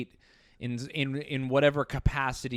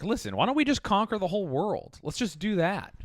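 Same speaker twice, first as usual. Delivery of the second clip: higher, breezy mock general proposing conquest; mock warmonger general; 7 semitones higher than usual. S0 mocking military rulers to S1, a breezy mock general. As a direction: toss off world conquest like a casual idea.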